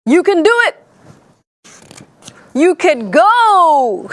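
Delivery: high, cheering pep talk; rally them, stretching out the final word; hammed-up rousing encouragement; motivational speaker. A narrator's reading. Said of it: "motivational speakers".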